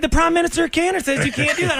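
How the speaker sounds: So what thrown out high and defiant; a high, hammed-up sheriff hopeful; high mock defiance. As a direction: fling it back in a high squeal. Parody officials.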